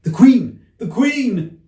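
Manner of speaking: loud high alarmed call. Acted. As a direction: shout the warning across the garden. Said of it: "called out".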